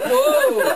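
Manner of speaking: high, loud drawn-out whoa; startled whoa. Acted. Storyteller showing the cow left hanging, high alarmed whoa. Cow dangling in the air, acted.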